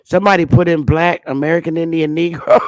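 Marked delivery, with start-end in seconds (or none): 2.2-2.7 bursts out laughing at the word; a high cackle of disbelief. Real, not acted. Laughing at the old census label.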